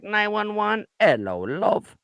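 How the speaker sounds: a hint of a Kansas drawl on a 911 call; lightly put-on Midwestern caller; faint Kansas accent impression; mock Kansas accent. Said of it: "that's not how they sound in Kansas".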